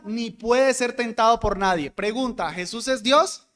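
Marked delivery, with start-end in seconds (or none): none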